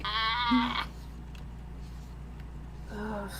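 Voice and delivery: ordinary delivery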